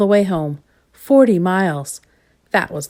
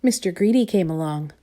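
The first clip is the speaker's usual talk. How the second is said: ordinary delivery, nothing marked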